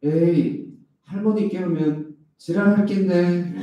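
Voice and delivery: put-on a kid warning in blunt Gyeongsang dialect; cheeky Gyeongsang-accented boy; Gyeongsang child. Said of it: "경상도 말을 배워갔습니다".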